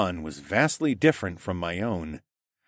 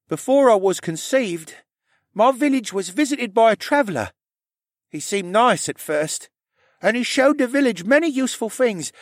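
put-on high young character voice; high-pitched, earnest tale-telling; Rylan, shy confessing stable boy; 12 semitones higher, 4 dB louder than usual. A narrator's reading.

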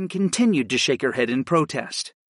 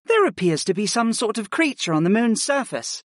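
higher-voiced, composed assessment; professional tone; 9 semitones higher than usual. A narrator's reading. Healer mage assesses the moon's surface, professional. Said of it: "assessed in a professional tone".

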